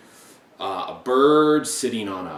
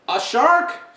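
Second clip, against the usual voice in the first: mock surprise; 13 semitones higher, 7 dB louder than usual. A narrator's reading. Mock disbelief reading to children.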